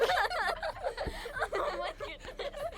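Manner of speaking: tiny high-pitched alien giggle; high squeaky giggle. Acted. Storyteller giggling after Granny's bloomers, high squeak.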